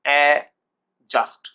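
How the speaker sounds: slow, loud a... just for অ্যাডজাস্ট; silent d. Shown as a demonstration. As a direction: stretch the a of অ্যাডজাস্ট, then just. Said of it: "ডিটার উচ্চারণ আসছে না".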